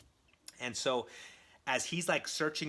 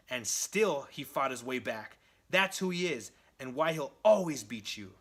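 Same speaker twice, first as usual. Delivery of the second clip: loud, raised, punchy acted tirade; defiant rant; 4 semitones higher, 6 dB louder than usual. Captive defending Spider-Man to the Green Goblin, loud defiance. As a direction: throw it in the villain's face, hitting key words hard. Prisoner defiantly rants at Norman.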